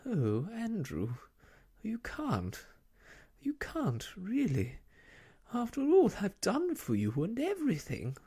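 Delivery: high, pleading, close to tears; nearly weeping. Acted. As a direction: beg the dog not to leave, voice rising. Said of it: "Miss Lark was nearly weeping".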